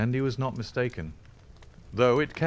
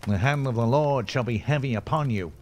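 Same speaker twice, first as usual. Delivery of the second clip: raised, loud preacherly threat; ringing, higher-pitched denunciation; crying out; 4 semitones higher, 5 dB louder than usual. A narrator's reading. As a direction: call down judgement on him. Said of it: "cried young Drebber".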